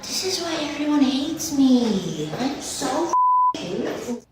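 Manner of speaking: normal talking voice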